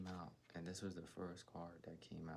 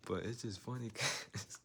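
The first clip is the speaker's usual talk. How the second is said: laughing; 3 semitones higher, 6 dB louder than usual. Real, not acted. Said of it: "I don't know why this is funny".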